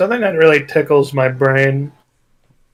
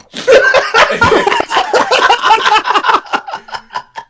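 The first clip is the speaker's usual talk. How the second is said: laugh. Real, not acted. Laughing at the pond story.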